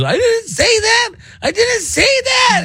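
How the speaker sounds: high, slow whiny mock sob; imitating crying kid. Kid denying to his dad, imitated crying whine. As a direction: whimper it like a caught kid. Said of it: "He starts crying".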